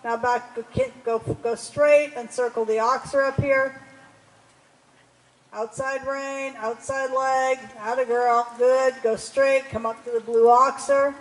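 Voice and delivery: loud, carrying riding instructions; calling across arena. Real, not acted. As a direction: project commands across the arena.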